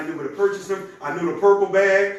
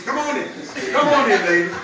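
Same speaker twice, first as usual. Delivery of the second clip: raised, welcoming call across the room; 4 semitones higher, 4 dB louder than usual. Real, not acted. Speaker waves late ladies into the meeting, calling out. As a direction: call latecomers in, higher and lively.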